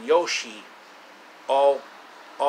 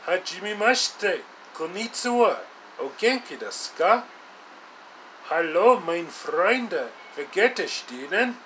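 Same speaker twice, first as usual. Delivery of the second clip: higher put-on voice greeting in Japanese and German; multilingual greeting; 5 semitones higher than usual. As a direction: show off his languages in a cheerful higher voice. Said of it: "he speaks multiple languages".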